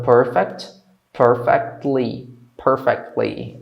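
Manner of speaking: PERFECTLY slowly, LY set apart; slow, segmented PERFECTLY; syllable-by-syllable model. Shown as a demonstration. Teacher joins perfect and ly slowly.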